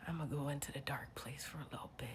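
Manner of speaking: low, hushed retreat into darkness; very soft, low and resigned; ominous whisper. Acted. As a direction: whisper it to yourself, sinking away. Retreating to let alter ego out.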